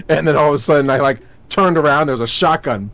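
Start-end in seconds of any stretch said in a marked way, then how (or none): none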